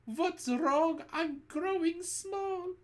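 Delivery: very high alarmed cry; boy crying out. A narrator's reading. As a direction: cry out as you shrink. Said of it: "cries out".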